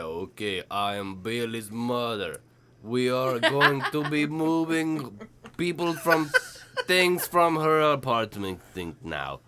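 slow, halting Russian mother; fake Russian accent. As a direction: play a Russian mom in broken English. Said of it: "your mother is Russian instead of from Boston".

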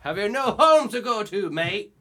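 loud, high Irish-Australian bark; Irish-Australian accent. Shown as a demonstration. Showing the friend the Irish-Australian character voice. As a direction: do an Irishman talking Aussie. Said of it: "Irish. Australian".

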